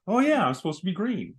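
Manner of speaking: lightly voiced plant, high sudden realization; playful "just remembered" lift; plants perking up. Host voicing plants after the rain, playful realization.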